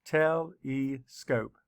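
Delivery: Telescope said slowly, te-le-scope; syllables split. Shown as a demonstration. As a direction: pace out Telescope beat by beat. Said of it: "Let's count the syllables".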